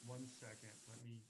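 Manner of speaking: very faint voice under hiss; whisper-quiet through a broken mic; quiet voice loud hiss. Real, not acted. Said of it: "you're so quiet".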